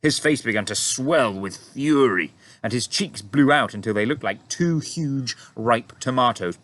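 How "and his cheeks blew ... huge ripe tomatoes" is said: plain reading voice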